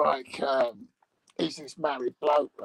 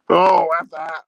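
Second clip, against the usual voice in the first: gloating shout; 2 semitones higher, 12 dB louder than usual. Real, not acted. Farting at the dog, gloating.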